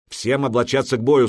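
commanding. A narrator's reading. Hragnir ordering everyone to dress for battle, commanding. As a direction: order the whole troop to arm. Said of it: "скомандовал Храгнир".

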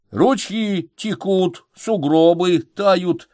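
slow, deliberate report voice. A narrator's reading. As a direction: report it like a dispatch. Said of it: "рапортует Мишка".

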